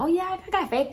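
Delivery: high-pitched, glib mimicry; mock an easy, untested confidence, pitched high; breezy confidence. Believer boasting of surface-level faith, breezy high voice.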